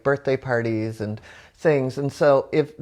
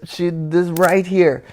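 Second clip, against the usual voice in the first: ordinary talk, nothing marked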